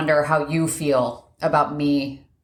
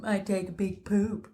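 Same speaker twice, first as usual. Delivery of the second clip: mock her husband's voice; imitating Kevin; 2 semitones higher, 3 dB quieter than usual. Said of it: "Anytime you imitate me".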